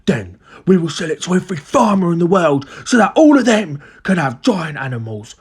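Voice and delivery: high, theatrical scheming dad; grand excitement. A narrator's reading. Father dreaming of a medicine factory, excited. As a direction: sell the grand plan, stretching key words. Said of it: "cried Mister Kiddie Cranky".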